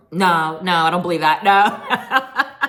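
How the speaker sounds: loud laughing no; mock cast scoffing with laughter; laughing disbelief. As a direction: laugh off her claim, loudly. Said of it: "the girls were".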